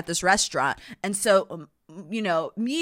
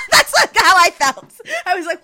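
high, loud, laughing speech; real laughter while talking; 11 semitones higher, 10 dB louder than usual. Real, not acted. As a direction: talk through a burst of laughter. Cracking up at her own reaction.

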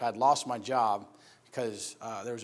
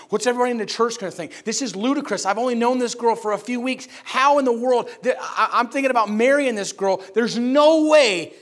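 earnest, frantic prayer; 6 semitones higher, 8 dB louder than usual. Retold or imitated. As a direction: let the worried questions pile up into an incredulous outcry. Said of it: "I'm praying in earnest, like, I'm crying".

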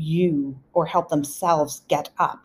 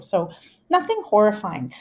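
usual speaking voice

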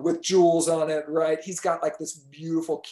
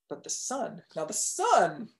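ordinary delivery, nothing marked

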